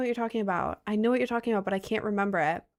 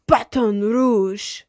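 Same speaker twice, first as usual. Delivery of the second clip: slow, loud, fancy French Baton Rouge; French accent; 7 dB louder, much slower than usual. Shown as a demonstration. Streamer to chat, saying Baton Rouge in a showy French accent. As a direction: say Baton Rouge like you're really French, savoring it. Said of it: "like, really French and saying that".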